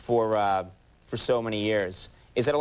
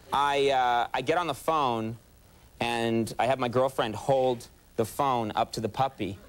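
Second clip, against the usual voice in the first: ordinary talk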